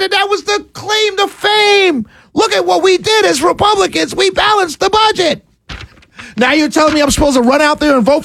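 high, impassioned rant; indignant radio tirade; angry yelling. Real, not acted. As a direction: raise the pitch in real outrage. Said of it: "I hear you yelling".

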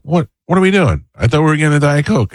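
high-pitched exasperated complaint; mock exasperation. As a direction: retell it as an indignant, put-out husband. Retold domestic quarrel over Diet Coke.

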